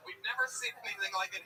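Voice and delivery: ordinary talk, nothing marked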